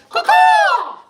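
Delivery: very high, loud crow-like cacaw; bird call cacaw shout. Retold or imitated. Wife imitating husband's store call, high shouted cacaw. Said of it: "He'll be like".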